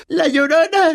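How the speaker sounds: high wailed llorona; sobbing. A narrator's reading. Myrtle crying her nickname, wailing. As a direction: wail la llorona through tears. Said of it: "entre sollozos".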